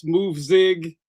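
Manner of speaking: normal talking voice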